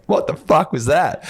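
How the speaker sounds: high, slow, stunned what-the; retelling a stunned reaction to friends; amazed disbelief. Retold or imitated. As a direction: blurt it out in wide-eyed astonishment. Group amazed after breath work.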